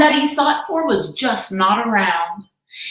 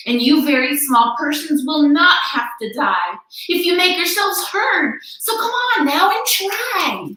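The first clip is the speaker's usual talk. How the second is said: high, pressing encouragement; rally them with all your heart; urgent call; 7 semitones higher than usual. A narrator's reading. Elephant urging the Whos while being caged. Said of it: "he managed to call to the mayor".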